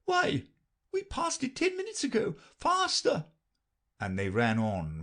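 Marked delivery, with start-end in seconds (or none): 0.1-3.3 very high queen voice; brisk queen. A narrator's reading. Queen hurries Alice on, very high and brisk. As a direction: dismiss her worry and push on. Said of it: "the queen repeated".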